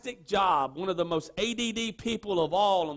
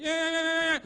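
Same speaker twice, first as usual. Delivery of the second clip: goat impression; 6 semitones higher than usual. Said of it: "That's my goat impression for you today".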